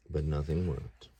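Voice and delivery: plain reading voice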